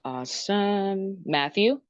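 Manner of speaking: usual speaking voice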